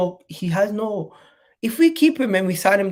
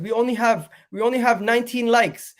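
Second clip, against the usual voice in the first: urgent, raised hype voice; excited; 6 semitones higher, 5 dB louder than usual. Real, not acted. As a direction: push the viewers to hit like. Urging viewers to like.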